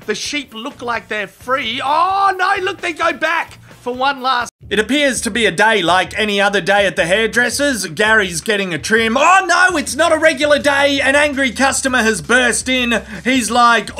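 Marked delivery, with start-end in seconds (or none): 1.8-4.5 alarmed oh no. Acted. Narrator alarmed at sheep. 9.2-13.0 loud, high burst of mock alarm; sudden loud mock shock; dramatic alarm.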